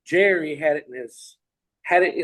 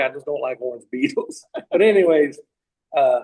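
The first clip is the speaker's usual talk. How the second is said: usual speaking voice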